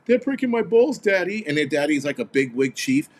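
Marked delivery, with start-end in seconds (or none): 0.1-1.5 high, whining mock of a spoiled kid; whiny mock rookie. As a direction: pitch it up and whine to daddy. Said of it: "They're like".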